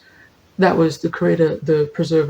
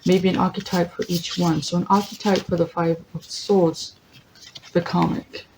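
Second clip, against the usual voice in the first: ordinary talk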